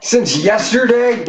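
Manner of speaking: high, drawn-out disbelief; incredulous echo. Real, not acted. Reacting to how much was drunk since yesterday, incredulous.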